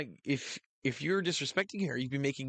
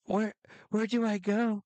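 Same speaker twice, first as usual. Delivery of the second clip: man voicing a woman, pitched up; man doing a woman's voice; 9 semitones higher than usual. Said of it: "you do a girl voice on Lynx".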